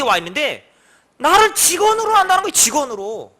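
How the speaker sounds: high, loud grumbling indignation; indignant complaint. Retold or imitated. Friend complaining to the pastor about the orphanage director, fuming. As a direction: fume about being treated as staff. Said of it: "막 씩씩거리는 거예요".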